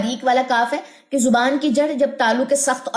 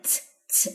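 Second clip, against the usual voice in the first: a short unvoiced hiss; स whispered to contrast it with ता; hissed s demo; 11 dB quieter than usual. Shown as a demonstration. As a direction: hiss स softly, with no voice. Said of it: "मैं सा नहीं पढ़ी".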